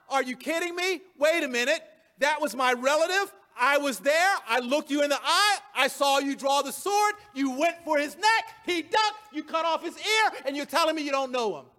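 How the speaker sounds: acted disbelief, pressing the point; incredulous accusation. Relative who saw the sword confronts Peter, incredulous.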